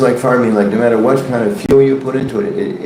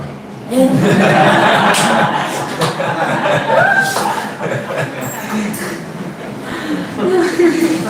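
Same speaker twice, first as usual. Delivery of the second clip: big laughter; 14 semitones higher than usual. Real, not acted. Audience laughs at punchline.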